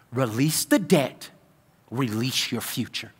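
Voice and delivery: soft, slow and low, sinking away; hushed sermon line. Acted. Pastor handing the congregation his key phrase, soft and slow. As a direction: lay the phrase down gently, almost to a hush.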